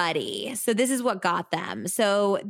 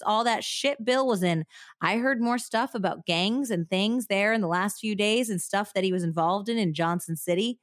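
put-on Southern accent. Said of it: "take out your Southern accent".

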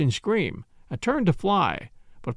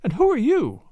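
higher-pitched, startled question; frightened demand; 7 semitones higher than usual. Acted. Woman finding strangers in her room, frightened demand. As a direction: demand it with a touch of alarm. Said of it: "with a quick, frightened glance".

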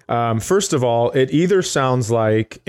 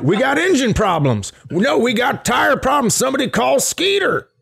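high, shouted pit crew voice; pit crew guy yelling; 10 semitones higher than usual. Retold or imitated. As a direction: yell it across the derby pit. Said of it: "Where he's like".

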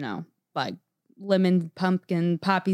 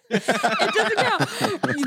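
laughing mock plea; 8 semitones higher, much slower than usual. Laughing mock of mom's excuse.

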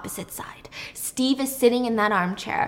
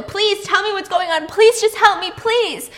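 high-pitched desperate begging; acted pleading, pitched right up, no real tears; sobbing plea; 10 semitones higher, 5 dB louder than usual. Noah begs Steve to be let go, pleading. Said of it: "starts sobbing, begging to be let go".

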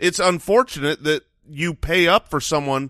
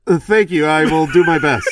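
muffled; 3 semitones higher, 7 dB louder than usual. Real, not acted. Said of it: "It sounds like you're in a bathroom".

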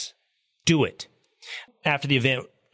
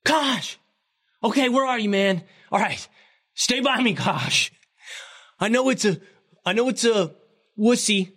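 much higher, hammed-up shout across the water; Hegseth's mock yell; 11 semitones higher than usual. Imitating Hegseth yelling to Kaj while floating, high mock shout. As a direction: call out for your swim buddy like a worn-out swimmer. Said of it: "and yelled".

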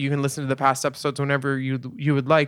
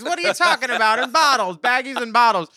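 mock outrage; 9 semitones higher, 4 dB louder than usual.

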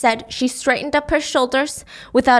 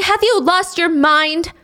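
mild mock scream; 6 semitones higher, slower than usual. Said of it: "She's screaming".

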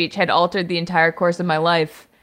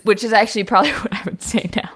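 ordinary talk, nothing marked

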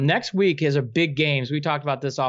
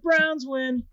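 higher, rising fan chant; excited cheer; 8 semitones higher, slower than usual. Pretend. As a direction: cheer it like the winning play.

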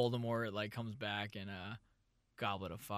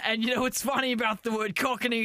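higher, hammed-up Cockney; put-on Cockney; 4 semitones higher, 3 dB louder than usual. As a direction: lay on a raised-pitch Cockney accent. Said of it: "We're so very Cockney".

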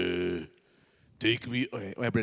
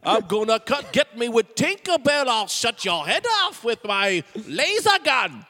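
raised, theatrical pirate threat; hammed-up pirate; 4 semitones higher, 4 dB louder than usual. Pirate threatening with a laser gun, hammed-up bluster. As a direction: menace them like a cartoon pirate. Playing pirate in improvised sketch.

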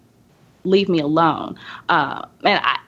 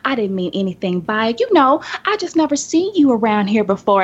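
mock sugary clerk; 6 semitones higher than usual. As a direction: imitate her sudden syrupy turnaround. Clerk apologising, voiced by teller.

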